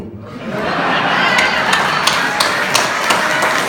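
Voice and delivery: breathless high wheezing laugh; helpless laughter. Real, not acted. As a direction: crack up at your own punchline. Laughing at own joke.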